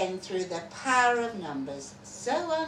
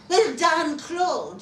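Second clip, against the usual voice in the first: hearty congratulation; 7 semitones higher, 8 dB louder, slower than usual. Retold or imitated. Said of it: "He said".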